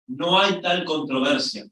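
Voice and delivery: ordinary delivery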